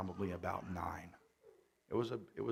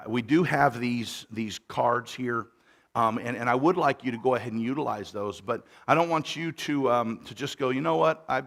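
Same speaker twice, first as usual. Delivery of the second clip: full-force church announcement; preaching voice; 3 semitones higher, 12 dB louder than usual. Acted. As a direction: project it from the pulpit. Preaching into microphone.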